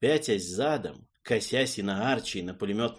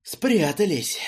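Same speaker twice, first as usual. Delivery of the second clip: sighing; 8 semitones higher, 6 dB louder, slower than usual. Acted. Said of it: "со вздохом сказал я".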